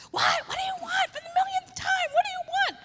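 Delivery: very high, loud mock snapping; hammed-up frazzled mother yelling at her child; crazy exasperated mom. Mom woken at night snaps at her toddler in a shrill mock yell. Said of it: "like a crazy mom that I sometimes am".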